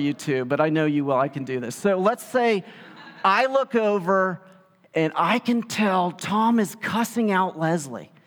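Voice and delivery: ordinary delivery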